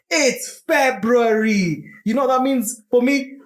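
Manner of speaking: excited. Real, not acted. Excited, high, slow.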